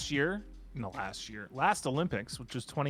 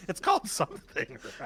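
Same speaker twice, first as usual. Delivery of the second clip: higher, laughing through the words; amused laughing. Real, not acted. Host laughing at not knowing the sport's name, amused. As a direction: crack up while saying it. Cracks up at own forgetfulness.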